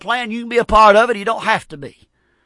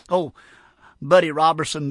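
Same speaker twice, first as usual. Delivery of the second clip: ordinary delivery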